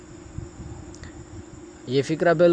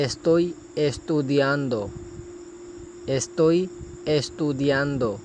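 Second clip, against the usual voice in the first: slow, careful Spanish, each syllable clear; slow model phrase; much slower than usual. Shown as a demonstration. Teacher modelling a Spanish phrase for students, slow and careful. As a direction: model it slowly for learners. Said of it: "اسی طرح آگے ہے".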